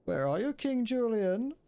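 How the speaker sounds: high, slow cartoon call; sustained high-pitched character voice; put-on cartoon voice.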